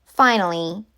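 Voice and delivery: usual speaking voice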